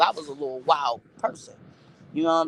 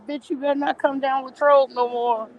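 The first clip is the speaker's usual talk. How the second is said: higher, put-on voice for the threat; imitating the junkie's warning; 8 semitones higher than usual. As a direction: warn her off like the old junkie. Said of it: "He was like".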